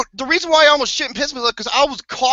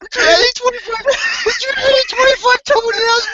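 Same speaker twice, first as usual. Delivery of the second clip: laughing, crying voice; 13 semitones higher than usual. Real, not acted. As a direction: squeal it out through laughter. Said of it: "I'm fucking ready to".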